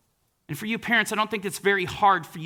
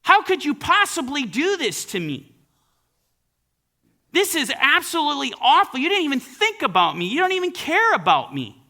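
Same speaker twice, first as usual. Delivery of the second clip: mock angry daughter; 6 semitones higher than usual. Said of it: "in raging anger, berating her father".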